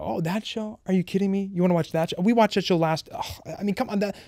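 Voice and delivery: high-pitched, indignant whine; mock outrage. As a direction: play up the fake outrage to win the argument. Mocking his own excuses to wife.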